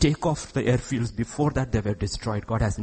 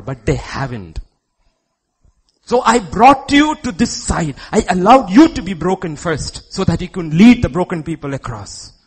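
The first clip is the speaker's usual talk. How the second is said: loud, raised, urgent preaching; preaching at full force; 5 semitones higher, 9 dB louder than usual. Real, not acted. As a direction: charge the shepherds in a ringing, loud voice.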